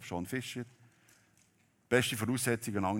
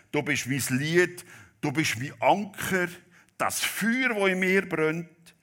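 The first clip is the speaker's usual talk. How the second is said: loud, higher, fervent proclamation of the hymn lines; preaching at full force; 8 semitones higher, 7 dB louder than usual. Acted. Preacher to congregation, reciting the hymn in full-force preaching. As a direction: proclaim it to the whole church, swelling louder.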